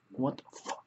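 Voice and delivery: hushed, voiceless disbelief; stunned whisper. Retold or imitated. Retelling a reaction to a too-physical dance partner, stunned whisper. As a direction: whisper it after a stunned beat. Acted shocked reaction after long pause.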